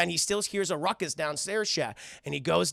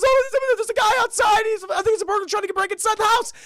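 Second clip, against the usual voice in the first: very high, loud frantic babble; hammed-up squealing panic; panicked alarm; 7 dB louder than usual. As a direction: imitate a cartoon dog raising the alarm. Panicked dog waking owners.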